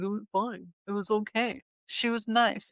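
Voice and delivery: understated, noncommittal guy reply; lightly imitated offhand answer; typical dude. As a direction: quote a dude giving away no details. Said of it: "such a typical dude".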